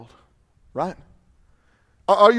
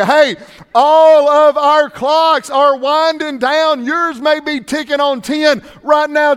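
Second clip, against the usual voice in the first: much higher, louder, slow preaching; urgent preaching; 9 semitones higher, 8 dB louder, slower than usual. Acted. Preacher warning congregation their clocks are winding down, preaching loudly and slowly. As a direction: hammer home that time is running out.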